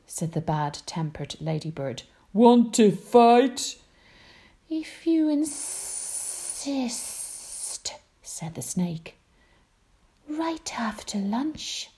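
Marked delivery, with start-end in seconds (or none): 2.3-3.8 drawn-out dare. Acted. Ladybird dares the snake. 4.7-6.7 slow drawn-out snake voice. Acted. Snake accepts slowly. 10.3-11.9 slow high whisper; whispering snake. Acted. Snake answers.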